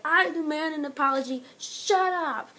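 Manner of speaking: imitating an indignant wrestler; mock angry demand. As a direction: demand it like an offended heel. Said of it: "he's like".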